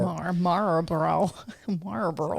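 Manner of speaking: Southern drawl. Retold or imitated. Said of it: "That's the Southern drawl".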